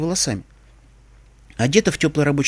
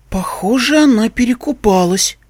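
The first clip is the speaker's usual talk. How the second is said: stunned; 7 semitones higher than usual. A narrator's reading. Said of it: "ошарашенно предположила Искорка".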